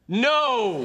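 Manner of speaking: long, high shouted no; emphatic refusal. Acted. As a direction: belt out one drawn-out refusal. Repeats refusal, supposedly in Spanish.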